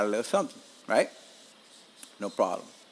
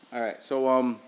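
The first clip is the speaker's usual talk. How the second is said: ordinary talk, nothing marked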